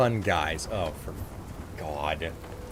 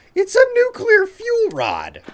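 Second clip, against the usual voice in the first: high, loud, drawn-out amazement; excited exclamation; 7 dB louder, slower than usual. Real, not acted. Gamer amazed at finding a fuel rod, excited exclamation. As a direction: exclaim it slowly in soaring disbelief. Astonished at a rare game find.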